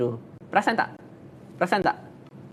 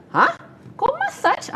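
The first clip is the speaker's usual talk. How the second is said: much higher, a startled squeak of disbelief; high-pitched shocked question; mock surprise; slower than usual. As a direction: react as if you can't believe it. Friend reacts in disbelief.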